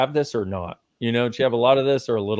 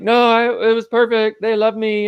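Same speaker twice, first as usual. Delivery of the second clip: higher, louder mock insistence of a perfect childhood; denying adult; 9 semitones higher, 5 dB louder than usual. Adult denying a hard childhood, high mock insistence. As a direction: play someone hiding from the truth, insisting. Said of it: "most people think they have to do is hide from it".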